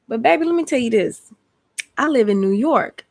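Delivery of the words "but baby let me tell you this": usual speaking voice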